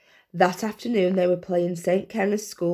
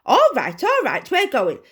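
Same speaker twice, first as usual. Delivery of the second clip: higher, louder put-on scolding; mock angry shout; 5 semitones higher, 4 dB louder than usual. A narrator's reading. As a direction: pretend to be cross. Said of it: "she shouted, pretending to be angrier".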